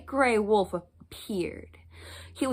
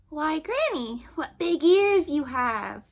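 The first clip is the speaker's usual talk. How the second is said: put-on higher, slow, wondering child; surprised little girl; 7 semitones higher, slower than usual. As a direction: marvel aloud like a small girl.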